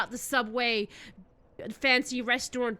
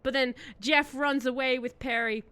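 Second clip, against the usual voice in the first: ordinary talk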